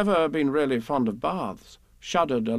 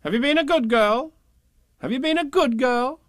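high, sing-song rising question; high-pitched, insistent grown-up; questioning parents; 12 semitones higher, 5 dB louder than usual. A narrator's reading. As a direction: quiz a small child in a high parental coo. Parents voiced repeating their question.

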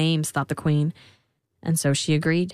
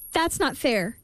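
high, mild objection; protesting girl; 7 semitones higher, slower than usual. A narrator's reading.

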